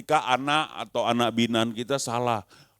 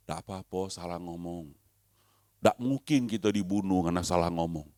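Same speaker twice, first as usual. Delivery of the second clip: much softer, gentle reassurance; reassuring; 7 semitones lower, 7 dB quieter than usual. Real, not acted. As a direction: ease their fear gently. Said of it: "reassuring the congregation". (menenangkan jemaat dengan suara lembut)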